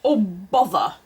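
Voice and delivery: grumbled. A narrator's reading. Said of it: "grumbled Mister Rabbit".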